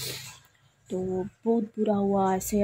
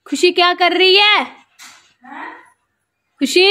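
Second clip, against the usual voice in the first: calling out; 9 semitones higher, 11 dB louder than usual. Real, not acted. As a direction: shout across to the little one. Calling to the child from afar.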